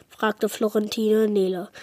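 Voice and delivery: ordinary delivery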